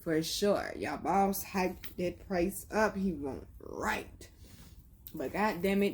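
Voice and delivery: ordinary talk, nothing marked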